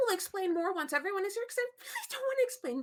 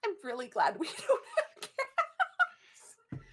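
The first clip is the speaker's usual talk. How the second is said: laughing; 4 semitones higher, 3 dB louder, much slower than usual. Real, not acted. Laughing through the line.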